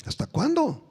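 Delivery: hasta cuándo pitched high, then falling; high plea. Acted. Preacher reading the Lord's question, pitched high. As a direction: lift ¿Hasta cuándo? high and let it drop. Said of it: "saber mis palabras".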